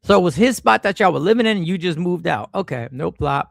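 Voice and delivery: ordinary talk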